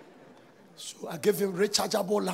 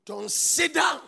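exhorting shout; 6 semitones higher, 9 dB louder, much slower than usual. Real, not acted. Preaching exhortation.

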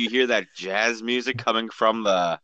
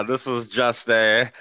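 usual speaking voice

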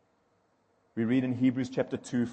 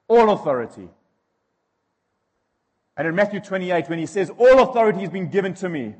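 louder, higher impassioned preaching; preaching at full force; 6 semitones higher, 8 dB louder than usual. Acted. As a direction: drive the point home to the congregation. Preaching on authority.

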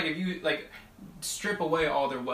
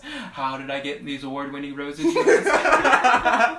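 mock proud gardener; 14 dB louder, slower than usual.

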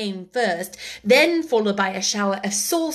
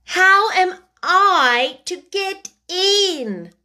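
Alice asks again, louder and slow; 8 semitones higher, 5 dB louder, much slower than usual. A narrator's reading. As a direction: raise the voice, pleading to get in. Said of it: "asked again in a louder tone".

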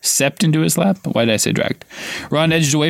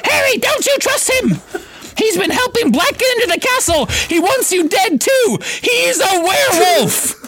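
Hermione screaming. A narrator's reading. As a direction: screech the accusation in panic. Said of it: "Hermione screamed".

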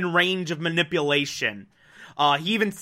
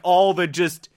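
normal talking voice

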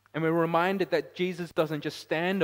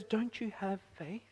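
softer, searching rebuke; Jesus's rebuke; 6 semitones higher, 6 dB quieter than usual. Acted. Jesus questioning the disciples' faith, soft mock rebuke. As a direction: ask it as Jesus would. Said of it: "here it says".

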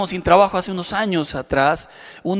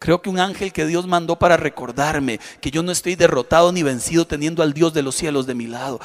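normal talking voice

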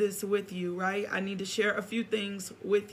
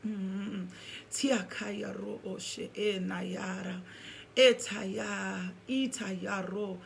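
praying in tongues; 4 dB quieter, much slower than usual. Real, not acted. Pastor opening in tongues, hushed and reverent. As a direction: let the syllables flow quietly, like private prayer.

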